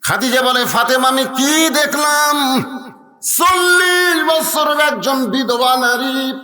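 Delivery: high, chanted sermon storytelling; impassioned preacher voicing a character. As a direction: intone the tale high, holding out the key words.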